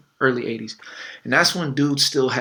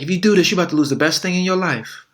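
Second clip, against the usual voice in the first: higher, louder hammed-up warning; mock ultimatum; 6 semitones higher, 5 dB louder than usual. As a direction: warn him, high and pushy. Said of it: "she's like".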